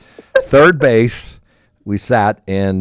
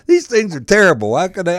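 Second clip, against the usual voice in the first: high, exasperated mock complaint; retold disgust; 9 semitones higher, 4 dB louder than usual. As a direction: imitate a friend appalled by the cigar. Retold friend gagging on a cigar.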